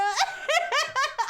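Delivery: hearty laugh. Real, not acted. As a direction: break into laughter. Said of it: "That's why I laugh so hard".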